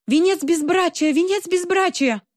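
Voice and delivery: high frantic shout. A narrator's reading. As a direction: scream it out desperately. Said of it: "кричала она".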